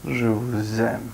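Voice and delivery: low, drawn-out declaration; slow demonstrative reading. Shown as a demonstration. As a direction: stretch each word out slowly, pitched low. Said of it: "Si je vous disais comme ça".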